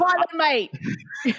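high mock-British catchphrase; bad British accent. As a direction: echo it in a badly faked British accent. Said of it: "I do a really bad accent".

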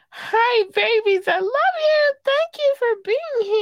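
put-on very high-pitched baby-talk gush; squealed affection. Greeting listeners in Germany, India, UK, squealed affection. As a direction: squeal it to adored fans. Gushing greeting to fans abroad.

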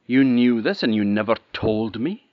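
furious eldest sister scolding; outraged. Acted. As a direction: round on her sister in fury.

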